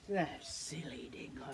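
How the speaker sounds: whisper. Acted. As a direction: whisper it like a snide remark. Emu's expression voiced.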